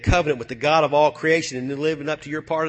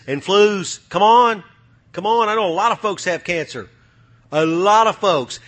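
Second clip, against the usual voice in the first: full-force sermon shout; fiery preaching; 3 semitones higher, 6 dB louder than usual. Acted. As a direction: preach it hard to the congregation.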